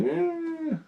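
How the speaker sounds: high thin whimpering cat sound. Retold or imitated. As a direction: squeak it like a small crying cat. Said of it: "this little whimpering".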